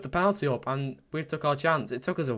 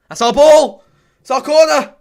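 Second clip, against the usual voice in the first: much higher, much louder protest; indignant yell; 12 semitones higher, 15 dB louder than usual. Real, not acted. Fan protesting the referee's call, indignant yell. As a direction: shout at the referee.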